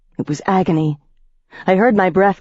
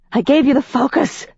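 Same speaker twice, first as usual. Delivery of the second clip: rasping; 10 semitones higher than usual. Acted. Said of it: "I rasped".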